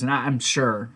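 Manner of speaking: ordinary talk, nothing marked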